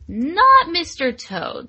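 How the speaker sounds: NOT said slow and emphatic; stress on NOT. Real, not acted. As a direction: hit "Not" hard, slowly. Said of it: "Let's be real".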